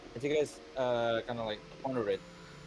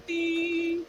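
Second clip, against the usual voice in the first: high ding; 4 dB louder than usual. Acted. Chime ending broadcast.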